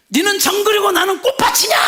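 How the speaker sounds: high, loud furious shout; wife yelling back at her husband, mock furious; wife's shout. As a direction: hurl it back at him. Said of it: "이렇게 나가요".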